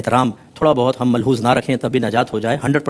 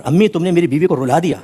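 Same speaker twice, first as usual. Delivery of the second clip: mock accusing son confronting his mother; complaining son; 5 semitones higher than usual. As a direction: act out a grown son scolding his mother. Son confronts mother, acted quote.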